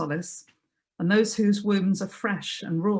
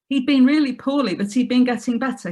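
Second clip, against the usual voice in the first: urgent insisting; 5 semitones higher, 6 dB louder than usual. Acted. Caller insisting, distressed.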